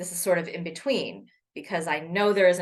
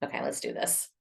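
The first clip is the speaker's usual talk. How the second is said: whisper; 7 dB quieter than usual. Real, not acted. Psyching herself up quietly.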